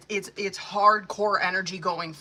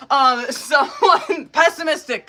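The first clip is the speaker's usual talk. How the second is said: laughs out the word, voice pitched up; giggling while softening a harsh reading; laughing; 6 semitones higher, 10 dB louder, slower than usual. Real, not acted. Tarot reader laughing as she describes the ex, giggling. Laughing through her own insult.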